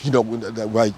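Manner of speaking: normal talking voice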